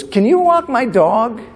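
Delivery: high, slow, drawn-out request; imitated female date. Date asks him a favor at the door, high drawn-out voice. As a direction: stretch out a high mimicked female question.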